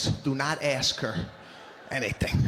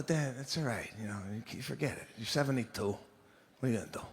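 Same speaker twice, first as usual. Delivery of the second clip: much softer, lower, dismissive-kind; gentle hushed son; 6 semitones lower, 9 dB quieter, faster than usual. Retold or imitated. Son telling his seventy-two-year-old dad to forget it, hushed. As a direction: ease dad off the idea softly.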